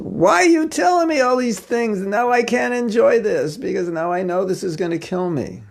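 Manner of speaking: high-pitched, aggrieved protest; put-on high whine, easing down; mock whiny complaint. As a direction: whine it like a spoiled treat. Acted complaint of spoiled eater.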